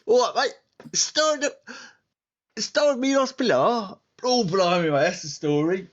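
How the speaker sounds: high, hammed-up Cockney old geezer; mock Cockney. Player teasing the DM, high mock Cockney. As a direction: ham up a Cockney for laughs. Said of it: "we want Cockney".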